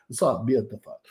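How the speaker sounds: playful mock Italian accent. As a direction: say it with a put-on Italian lilt. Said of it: "Although that's a bit Italian".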